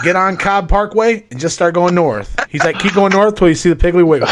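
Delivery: mock directions.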